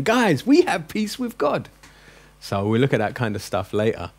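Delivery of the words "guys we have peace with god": hammed-up Paul. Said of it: "Or is he saying".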